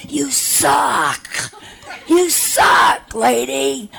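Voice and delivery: high shouted taunt; acted bratty yell; jeering kid. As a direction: heckle her like an angry kid.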